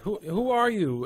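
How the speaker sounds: demanding call with a lifted voice. Acted. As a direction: call out to question a stranger. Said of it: "call out in Elven".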